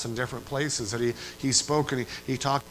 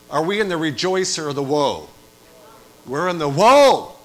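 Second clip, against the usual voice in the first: loud again, pressing the room for an answer; louder repeated call; 3 semitones higher, 10 dB louder than usual. Acted. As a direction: demand a real answer. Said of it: "thank you for four of you".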